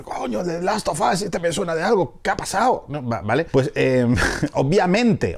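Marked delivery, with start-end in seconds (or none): 0.0-2.8 high, slowed puzzled viewer; lightly hammed-up confused exclamation; mock surprise. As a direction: imitate a fan half recognising an actor. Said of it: "y veíamos a Pedro Pascal".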